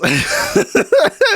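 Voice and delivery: high, loud burst of laughing; real high-pitched laugh; loud laughter. Real, not acted. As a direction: crack up at your own impression. Laughing at own accent impression.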